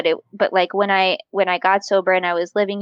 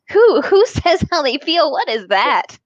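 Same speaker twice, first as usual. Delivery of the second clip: high, louder laughing disbelief; laughing at how absurd it seemed; incredulous laugh; 6 semitones higher, 4 dB louder than usual. Real, not acted. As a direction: scoff at the very idea, laughing. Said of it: "mocking idea of expressing feelings".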